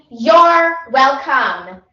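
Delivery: slow, loud you're welcome; drawn-out you're welcome. Shown as a demonstration. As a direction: stretch it out grandly. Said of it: "I mean".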